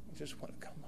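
breathy whisper, barely voiced; whispered plea. Daughter on the phone begging to come home, whispered. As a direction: let the plea fade to a whisper.

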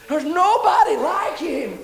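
high, loud and slow, every word stretched; shouted praise. Acted. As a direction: proclaim it to the rafters. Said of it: "repeated praise line, shouted".

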